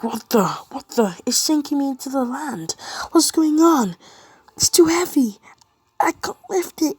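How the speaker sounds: high, loud, hammed-up panic; panicked shouting. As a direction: cry out in mock alarm, straining upward. All Might sinking under the hammer.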